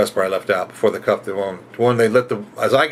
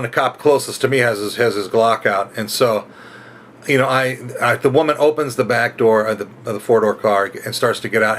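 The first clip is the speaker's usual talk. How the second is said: ordinary talk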